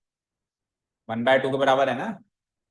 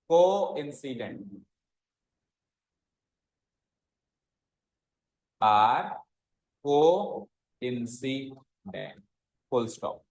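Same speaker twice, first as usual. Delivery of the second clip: extremely slow, syllables stretched; slow dictation; 5 semitones lower, 3 dB quieter, much slower than usual. Shown as a demonstration. Teacher dictating the answer line, stretched out. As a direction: draw out each word for copying. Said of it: "तो क्या लिखेंगे?".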